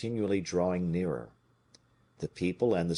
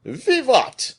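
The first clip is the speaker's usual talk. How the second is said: high, loud cheer; a big booming hurrah from a happy soldier; cry of joy; 11 dB louder than usual. A narrator's reading. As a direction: shout it out in triumph. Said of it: "cried Porthos".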